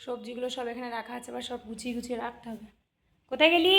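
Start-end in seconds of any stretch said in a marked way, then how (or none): 3.3-3.7 calling out. Real, not acted. Mother calls out for kids.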